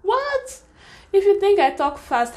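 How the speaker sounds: high, indignant "what?" reaction; playfully offended comeback, pitch jumping up; incredulous retort. Retold or imitated. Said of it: "And I'm like".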